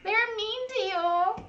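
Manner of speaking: slow, high, pitying coo; tender. Retold or imitated. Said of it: "He was like".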